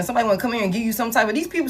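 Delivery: usual speaking voice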